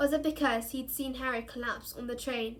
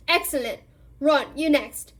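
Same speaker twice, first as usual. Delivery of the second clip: loud call; 3 semitones higher, 10 dB louder than usual. A narrator's reading. Lupin calling next pupil.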